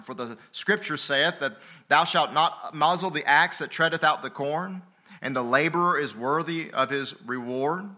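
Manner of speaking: emphatic, projected Bible recitation; scripture proclamation. Real, not acted. Pastor citing scripture on rebuking elders, emphatic proclamation. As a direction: declaim the verse with steady force.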